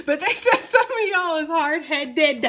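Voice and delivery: high, loud, drawn out through laughter; laughing tease. Retold or imitated. Card reader passing on spirits' teasing to viewers, laughing. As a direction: crack up while relaying the teasing message.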